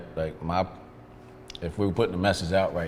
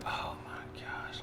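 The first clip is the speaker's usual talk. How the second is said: breathless whisper, slow and scared; whispered under his breath in the car; whispered panic; 13 dB quieter, much slower than usual. Retold or imitated. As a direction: hush it like you dread what's coming. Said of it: "And I'm like".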